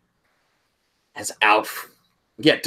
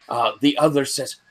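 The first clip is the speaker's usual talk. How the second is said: normal talking voice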